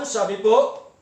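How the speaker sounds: usual speaking voice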